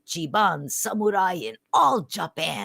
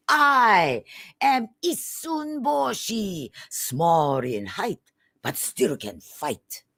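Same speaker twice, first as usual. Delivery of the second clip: tiny samurai announcing himself with pride; heroic proclamation; 2 semitones higher than usual. Acted. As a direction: proclaim yourself boldly to the shogun.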